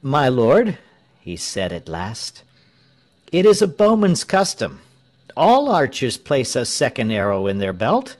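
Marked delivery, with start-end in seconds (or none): 0.0-0.8 higher, louder address with a questioning rise; uneasy reply. A narrator's reading. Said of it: "Tell looked down uneasily". 3.3-8.2 high-pitched, louder innocent explanation; uneasy excuse. A narrator's reading. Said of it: "Tell looked down uneasily".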